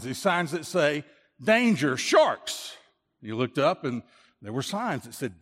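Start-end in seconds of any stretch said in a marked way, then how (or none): 0.0-2.8 acted alarm, punching out the danger; emphatic warning. Friend pointing out shark signs to swimmer, emphatic warning. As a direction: voice climbing high and loud.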